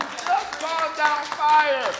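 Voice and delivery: loud, high, drawn-out exclamation; big shouted reminder; incredulous shout. Acted. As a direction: belt it out slowly in disbelief. Same retort, now shouted.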